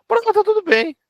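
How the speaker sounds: high-pitched mock "all fine"; high, dismissive caricature; breezy mock spouse. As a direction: toss it off in a high put-on voice. Voicing the unbothered spouse.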